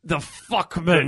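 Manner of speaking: drawn-out mock-priest intonation; old Irish priest. Co-host mimicking the priest, slow hammed-up delivery. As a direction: stretch it slowly like the film's priest. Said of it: "I love the way he says that".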